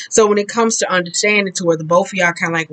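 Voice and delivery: ordinary talk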